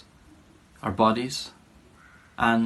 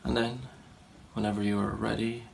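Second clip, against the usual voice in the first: softer, unhurried meditation guidance with long pauses; guided meditation; 6 dB quieter than usual. Real, not acted. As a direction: gently settle listeners into a body scan, leaving space between phrases. Leads guided body scan meditation.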